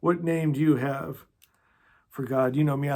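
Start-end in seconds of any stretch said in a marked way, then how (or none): none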